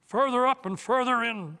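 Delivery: high, loud triumphant roar; ringing unicorn's battle cry. Acted. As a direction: roar the call for all to follow. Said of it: "roared the unicorn".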